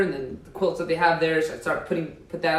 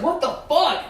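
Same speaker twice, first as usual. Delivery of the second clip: high mock-dad outburst; angry dad; 11 semitones higher than usual. Dad coming home to puke everywhere, mock outraged. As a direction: play the shocked dad walking in.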